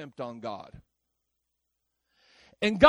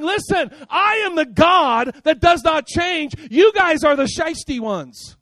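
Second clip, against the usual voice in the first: God rebuking; 8 semitones higher, 6 dB louder than usual. Retold or imitated. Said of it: "So God is saying".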